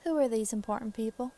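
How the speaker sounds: Southern lady's questioning voice; Southern accent. Acted. Wife questioning the investigator, Southern accent. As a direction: ask it as a Southern farm wife. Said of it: "that's not her voice".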